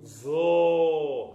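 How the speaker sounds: ゾー sustained slowly and loudly; long vowel. Shown as a demonstration. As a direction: hold ゾー long and full. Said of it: "ゾーは長めに".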